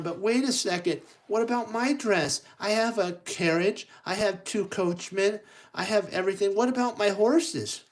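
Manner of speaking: high-pitched, worried heroine voice; high, pleading storybook girl; fretting Cinderella. Acted. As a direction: protest in a high voice, piling up worries.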